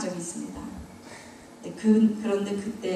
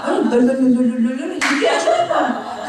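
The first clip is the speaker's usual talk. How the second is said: imitating tongues babble; 3 dB louder than usual. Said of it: "그분 방언이 그랬어요".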